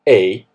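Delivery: A said loud and drawn out, mock English "ay"; A stretched out English style. Shown as a demonstration. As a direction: mimic how the English say A. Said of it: "confuse that A with".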